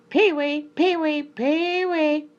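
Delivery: high, loud farmer's bird call. A narrator's reading. Farmer calls the birds to come, high and loud. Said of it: "When the farmer called".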